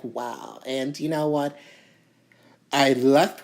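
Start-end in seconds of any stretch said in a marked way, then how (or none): 0.0-0.6 soft, low stunned wow. Retold or imitated. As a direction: breathe out in disbelief. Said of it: "I was just like".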